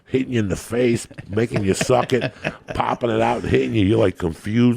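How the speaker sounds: normal talking voice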